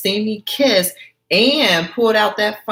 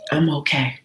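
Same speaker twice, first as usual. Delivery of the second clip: whispered; 3 semitones lower than usual. Acted. Gina hiding hurt.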